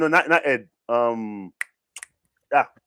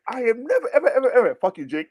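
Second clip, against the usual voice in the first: mock rant; 7 semitones higher than usual. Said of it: "Mo went on a full rant".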